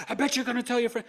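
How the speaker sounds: high, loud imitation of a ranting doctor; mock screaming. ER doctor berating an unvaccinated patient, mock scream. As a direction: mimic her hectoring shriek. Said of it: "So she's screaming".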